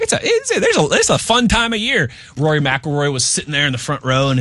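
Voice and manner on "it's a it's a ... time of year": mock delight. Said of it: "I was like".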